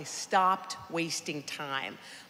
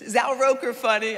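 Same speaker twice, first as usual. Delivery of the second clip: eager stranger; 6 semitones higher, 8 dB louder than usual. Retold or imitated. Stranger asks about coworkers.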